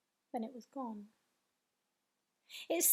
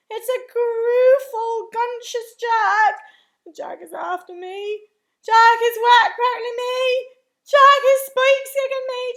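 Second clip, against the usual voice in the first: bellowed; 9 semitones higher, 17 dB louder than usual. A narrator's reading. Giant attacked by Jack in a nightmare, bellowed terror. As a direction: wail at full volume, stretching the words. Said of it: "bellowed the flesh lump eater".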